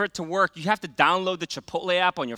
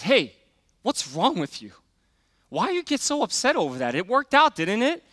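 imitated wife's challenge, rising higher; lightly voiced wife; 4 semitones higher than usual. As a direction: mildly scold like a puzzled wife. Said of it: "then my wife".

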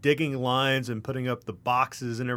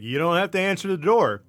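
raised, much higher and louder; mock telling-off at high volume; exasperated retort; 7 semitones higher, 9 dB louder than usual. Son retells scolding mom about answering the door, loud exasperation.

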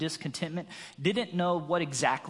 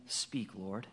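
much softer and lower, hushed plea; quiet prayer; 8 semitones lower, 10 dB quieter than usual. Shown as a demonstration. Preacher models the humble prayer, hushed and low. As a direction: breathe it low and soft. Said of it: "Get in a habit of saying".

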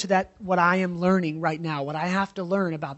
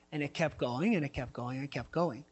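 fading to quiet; 5 semitones lower, 7 dB quieter than usual. Real, not acted. Pastor telling his family the joking went too far, fading quiet. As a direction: repeat it softer each time, sinking lower. Repeated build to hurt feelings.

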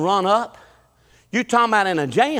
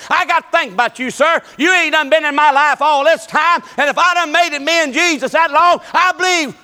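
building preaching; 6 semitones higher, 4 dB louder than usual. Acted. Preacher testifies of God bringing his daughter back, rising to a high loud climax. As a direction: build it up toward the shout.

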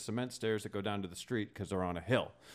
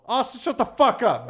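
high, loud snap at Austin; angry yell; 10 dB louder than usual. Retold or imitated. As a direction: shut him down, loud and high. Yelling at Austin.

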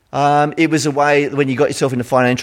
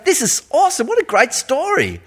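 much higher, gushing enthusiasm; mock delight; 10 semitones higher than usual. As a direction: gush like a thrilled listener.

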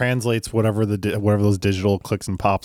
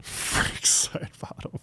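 whisper; 10 dB quieter, much slower than usual. Acted. Echoing the name in awe.

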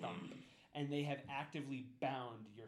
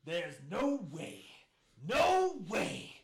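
angry sheriff; 2 semitones higher, 8 dB louder, slower than usual. Acted. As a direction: bellow it down at the prisoner. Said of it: "He looks at you like".

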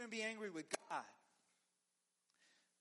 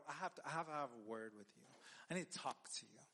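normal talking voice

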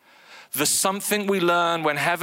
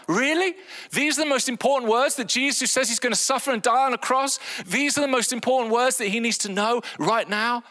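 much higher, fast disbelief; incredulous; 8 semitones higher than usual. Real, not acted. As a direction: ask it as if it can't be true. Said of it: "incredulous rhetorical question".

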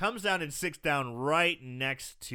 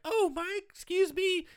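much higher, breathy-free coy "oh my"; coy lady voice; 3 dB louder than usual. Retold or imitated. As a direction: play the bombshell, all innocent and coy. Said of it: "And she's like".

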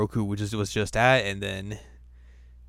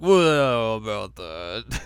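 loud well then slow trailing about that; sheepish dodge; 5 dB louder, much slower than usual. Retold or imitated. Moroku caught out, stalling slowly to his questioners.

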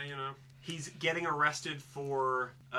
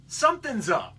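suspicious; 6 semitones higher, 8 dB louder than usual. Retold or imitated. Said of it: "he plays most of the movie like".

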